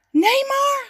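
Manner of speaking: very high, loud exclamation; astonished call. A narrator's reading. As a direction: cry out in amazement. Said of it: "roept hij". (stomverbaasd uitroepen, heel hoog)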